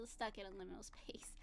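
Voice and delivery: trailing into a whisper. Real, not acted. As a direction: drop to a breathy whisper. Drifting into hushed aside.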